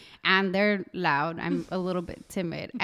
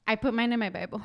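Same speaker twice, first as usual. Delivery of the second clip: normal talking voice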